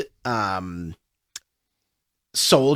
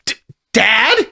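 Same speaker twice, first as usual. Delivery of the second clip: high, stammered surprise; startled recognition; 8 semitones higher, 4 dB louder than usual. Acted. Son startled to see his father.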